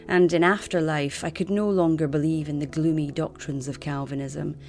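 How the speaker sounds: ordinary talk, nothing marked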